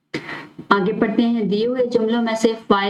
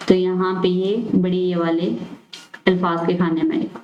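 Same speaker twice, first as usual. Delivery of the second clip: ordinary delivery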